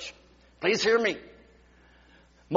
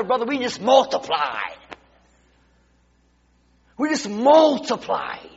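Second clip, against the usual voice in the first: louder, driving preacher cadence; full-force preaching; 5 dB louder than usual. Acted. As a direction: preach it to the back row.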